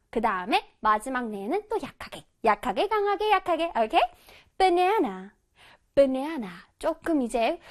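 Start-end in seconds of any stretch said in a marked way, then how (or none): 4.6-6.5 "banana" with a buttery, exaggerated American lilt on "na"; slightly greasy banana. Shown as a demonstration. Teacher modeling a slightly cheesy American "banana" for kids. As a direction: lay the American accent on a little thick. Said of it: "약간 느끼하게 발음을 해주는 게".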